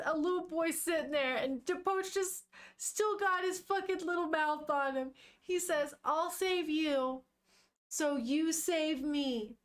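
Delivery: high, drawn-out tender ache; touched, saddened. Real, not acted. Streamer reading a touching manga plea to viewers, saddened. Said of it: "why is that so sad to me".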